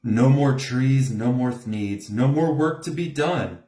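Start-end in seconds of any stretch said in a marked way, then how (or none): none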